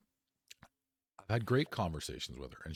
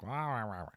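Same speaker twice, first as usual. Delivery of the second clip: put-on kid's whiny wah wah, pitched up; mock whining wah; 4 semitones higher than usual. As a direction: mimic a kid whining back at his mom. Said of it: "I was, like, barking back".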